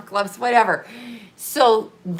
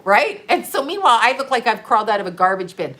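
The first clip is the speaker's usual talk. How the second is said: ordinary talk, nothing marked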